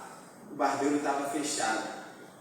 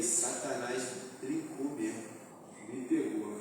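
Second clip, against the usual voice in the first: defeated murmur; 5 semitones lower, 12 dB quieter than usual. Retold or imitated. Retold despair about haircut.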